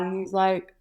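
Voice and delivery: ordinary delivery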